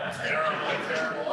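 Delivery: ordinary talk, nothing marked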